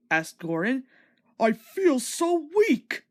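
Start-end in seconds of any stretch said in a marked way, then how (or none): 1.4-3.0 high, slow, feeble engine voice; broken-down engine sighing weakly. A narrator's reading. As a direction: let it sag out slowly, worn out. Gordon after bursting valve.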